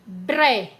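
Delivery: Bré said on its own, stressed; last syllable alone. Shown as a demonstration. Teacher showing the last syllable to children. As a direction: isolate Bré as one beat. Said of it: "é a última sílaba".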